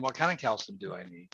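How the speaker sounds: usual speaking voice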